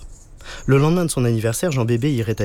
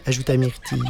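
usual speaking voice

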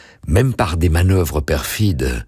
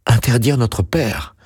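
alarmed cry; 8 semitones higher than usual. A narrator's reading. Two children clinging to their father, crying out in alarm. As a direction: cry out in disbelief, pitch leaping up. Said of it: "crièrent les deux enfants".

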